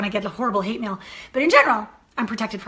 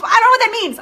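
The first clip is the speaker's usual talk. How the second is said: baffled; 11 semitones higher, 10 dB louder than usual. Retold or imitated. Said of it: "she goes".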